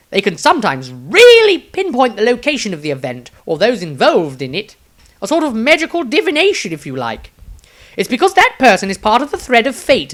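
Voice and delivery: high, loud, eager wizard voice; excited eccentric wizard. Acted. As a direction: gush it out like a dotty old wizard. Maestro the wizard answers.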